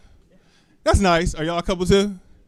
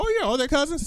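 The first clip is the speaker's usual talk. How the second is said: higher, softer put-on voice; lightly mock a polite onlooker; 7 semitones higher, 5 dB quieter than usual.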